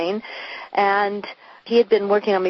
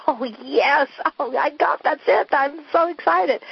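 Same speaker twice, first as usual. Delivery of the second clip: much higher, giddy rush of relief; excited; 8 semitones higher than usual. Retold or imitated. Retelling her thrilled moment of decision, much higher excited voice. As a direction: replay the thrilled moment, pitched way up. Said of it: "I went".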